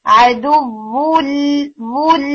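عدو ول with the lam fully relaxed, lips neutral. Shown as a demonstration. Tutor demonstrating relaxed lam to students, slow and clear. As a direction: say عدو ول slowly, lips at rest, no smile. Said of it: "لام کو بالکل relax کر دیں گے".

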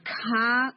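کھا said with a breathy puff after the ک; کھا with aspiration, the wrong way; wrong aspirated kaaf. Shown as a demonstration. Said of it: "کھا کر کے نہیں بولنا".

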